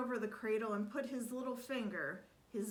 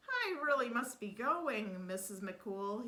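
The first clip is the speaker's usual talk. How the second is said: usual speaking voice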